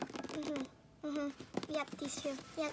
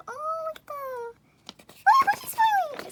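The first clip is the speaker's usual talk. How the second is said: high, loud fangirl squeal; delighted squeal; 10 semitones higher, 9 dB louder than usual. Real, not acted. Fan seeing the idol smile, loud squeal.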